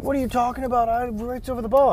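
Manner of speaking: high-pitched mock indignation; imitated protest, voice pushed up high; angry captain. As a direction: argue back like an outraged player. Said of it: "he was very angry, obviously".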